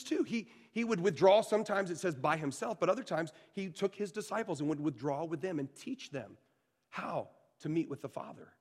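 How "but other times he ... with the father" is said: ordinary talk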